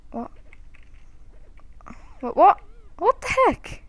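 high, startled what the heck; shocked. Real, not acted. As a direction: squeal out in disbelief. Shocked at game event.

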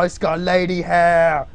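ordinary talk, nothing marked

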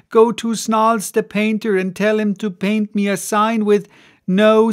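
bustling innkeeper bemoaning her house; landlady wailing over her ruined rooms; fretting landlady. Acted. Landlady lamenting, voiced throughout.

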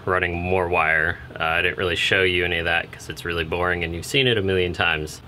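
ordinary talk

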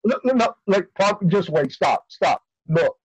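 ordinary talk, nothing marked

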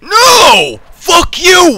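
high, loud furious yell; angry shout. Real, not acted. Gamer yelling at the level after failing, furious and high. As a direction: scream at the game in rage.

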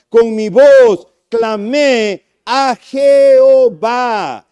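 loud, slow, drawn-out proclamation; fervent cry. Shown as a demonstration. Preacher leading the congregation to repeat, booming slow. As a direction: call it out so the church repeats it. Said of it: "repita conmigo lo que dice la palabra".

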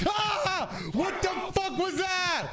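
high slow shocked shout; startled shout. Real, not acted. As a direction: yell in shock. Startled scream.

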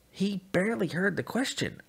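plain reading voice

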